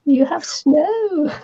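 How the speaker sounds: slow, rising in surprise; drawn-out amazed repeat; surprised echo. Real, not acted. Echoing surprising news of snow.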